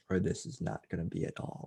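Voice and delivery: hushed inner aside. Retold or imitated. Nervous inner thought on stage.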